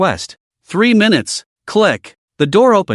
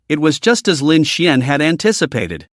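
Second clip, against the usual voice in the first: usual speaking voice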